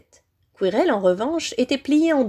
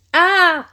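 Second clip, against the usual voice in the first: loud, high scream of agony; pained scream; 9 semitones higher, 7 dB louder than usual. A narrator's reading. Servant burnt by the boy's hands, screaming.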